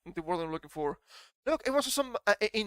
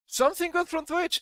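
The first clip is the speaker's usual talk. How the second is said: surprised; 10 semitones higher than usual. Real, not acted. Surprised by a good comment.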